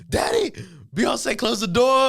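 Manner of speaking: put-on high, loud childish telling-on; whiny kid voice calling out to dad; tattling little sister. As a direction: shout it up to daddy like a wronged eight-year-old. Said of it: "She just goes downstairs".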